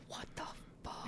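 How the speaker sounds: breathless whispered disbelief; shocked whisper. Retold or imitated.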